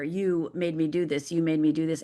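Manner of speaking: ordinary talk, nothing marked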